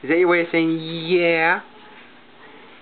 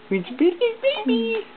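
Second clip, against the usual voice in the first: even higher coo. Real, not acted. Parent cooing at the baby, pitched up even higher.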